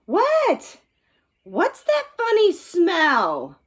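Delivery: high, slow puzzled sniff. Acted. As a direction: wonder what that smell is. Dad sniffing breakfast.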